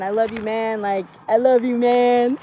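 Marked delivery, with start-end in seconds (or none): 1.3-2.4 drawn-out, louder buddy declaration; hammed-up bro affection. Showing friends how people say love casually, hammed-up affection. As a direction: stretch out a mock-hearty pal's love.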